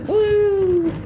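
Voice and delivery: high-pitched "woo!"; playful whoop. Real, not acted. Streamer moving a little bit, whooping playfully. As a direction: whoop high as you move.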